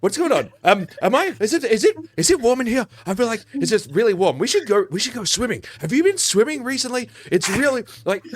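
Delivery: high, loopy, rambling drugged voice; dazed drugged guard. Acted. GM voicing a drugged guard to the players, high and loopy. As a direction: babble like a sedated guard who's lost the plot.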